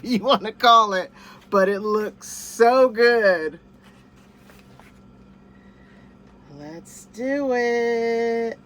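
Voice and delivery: loud, slow, eager build-up; excited. Real, not acted. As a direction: savor the anticipation. About to bite taco.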